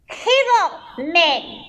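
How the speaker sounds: put-on high Russian-accented purr; Russian vampire ballerina. Vampire ballerina greets her superhero co-star, Russian accent. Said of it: "she was Russian".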